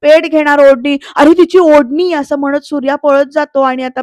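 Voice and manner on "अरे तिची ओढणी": loud, higher alarmed cry; alarmed shout. Acted. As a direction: shout a warning as you run. Sees the scarf catching fire. (घाबरून मोठ्याने ओरडणे)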